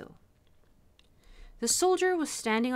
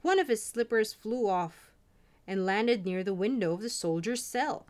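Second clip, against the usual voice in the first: ordinary delivery, nothing marked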